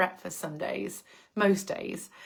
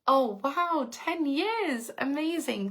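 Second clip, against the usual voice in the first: delighted surprise; 6 semitones higher, slower than usual. Real, not acted. Surprised by ten years' following.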